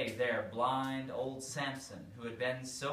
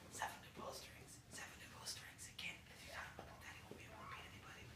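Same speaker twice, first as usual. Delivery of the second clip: secret whisper through the door; hurried conspiratorial whisper; 15 dB quieter, faster than usual. Acted.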